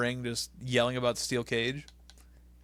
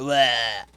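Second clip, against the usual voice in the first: tongue-out bleh; 6 dB louder than usual. Retold or imitated. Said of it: "took out his tongue and went".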